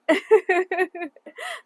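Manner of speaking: high, loud burst of laughter; amused high-pitched laughing; real laugh. Real, not acted. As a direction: laugh out loud at your own remark. Laughs at the price.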